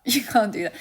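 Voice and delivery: laughing insistence. Shown as a demonstration. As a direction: press the point with a laugh. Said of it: "repeats for emphasis".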